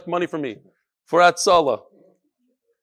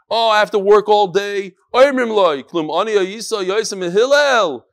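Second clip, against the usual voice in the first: loud singsong teaching chant; Talmud recitation; 3 semitones higher, 6 dB louder than usual. Real, not acted. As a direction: chant the text out to the room, stretching line ends. Recites and translates the Gemara aloud.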